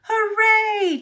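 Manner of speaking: high, loud joyful toot; tooted hooray. Acted. Rescued engine cheers as he is back on track. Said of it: "tooted Thomas".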